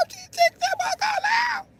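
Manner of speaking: very high squeaky awe; amazed squeal. Retold or imitated. Grandson amazed at nana, squeaky high. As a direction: squeal it in disbelief.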